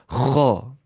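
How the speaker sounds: "खा" with long throat friction, rounded vowel; Tajweed full-mouth "खा"; heavy kha. Shown as a demonstration. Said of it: "मोटी आवाज़ से पढ़ना होता है".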